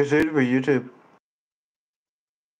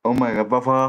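ordinary talk

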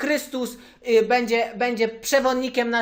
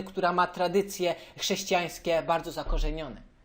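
ordinary talk, nothing marked

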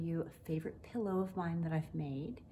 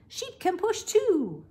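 high, louder, slow frog voice; lightly voiced frog with an idea; frog croaking; 10 semitones higher, 10 dB louder, slower than usual. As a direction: frog proud of his plan. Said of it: "croaks Frog".

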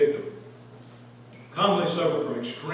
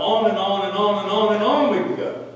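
loud, higher rolling repetition; emphatic piling-up; 5 semitones higher, 5 dB louder than usual. Real, not acted. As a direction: keep rolling it out, louder and higher. Preacher piling up endless proofs.

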